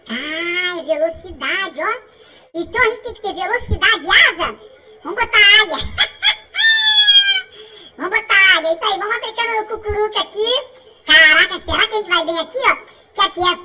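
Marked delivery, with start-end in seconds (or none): 5.8-7.6 loud high giggle; gleeful laugh; high burst of laughter. Real, not acted. Laughs while playing.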